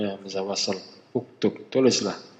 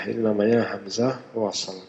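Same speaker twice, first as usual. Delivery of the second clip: ordinary talk, nothing marked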